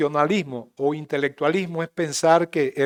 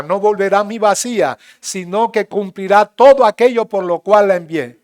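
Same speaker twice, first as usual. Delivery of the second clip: loud, higher preaching; raised preaching voice; 3 semitones higher, 8 dB louder than usual. Real, not acted. Pastor raises his voice to draw amens, loud. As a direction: lift the voice to stir amen. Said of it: "Si no alzo la voz así fuerte".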